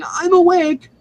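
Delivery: high, loud cry of waking up; mock excited yell. Sleeper leaping out of bed in five seconds, mock yelling.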